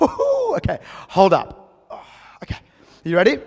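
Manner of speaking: hammed-up bracing to answer; flustered mock reaction. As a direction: act out excited panic at a big question. Imagined startled reply to question.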